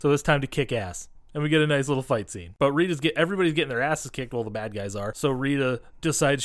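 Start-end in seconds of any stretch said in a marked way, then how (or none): none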